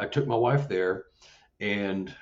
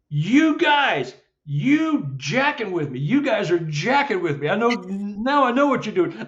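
retold accusing call; 10 semitones higher, 4 dB louder than usual. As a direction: call out to the culprits, pitch well up. Retells accusing the unseen creatures.

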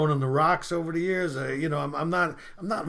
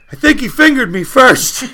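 loud, higher mock complaint; wailing patient; 6 semitones higher, 12 dB louder than usual. Patient confessing to the doctor, loud mock lament. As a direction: belt out the punchline.

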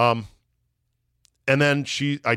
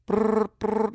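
high mock ringtone trill; phone ring noise; 11 semitones higher than usual. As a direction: imitate a ringing phone. Imitating a phone ringing.

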